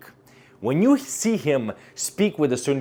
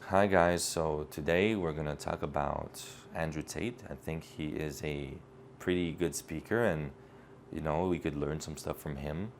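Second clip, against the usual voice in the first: much lower and softer, limp and unsure; a deflated speaker with no certainty, much quieter; low-energy delivery; 8 semitones lower, 11 dB quieter than usual. Shown as a demonstration. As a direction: mumble it like you have no confidence at all. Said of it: "I was speaking like this".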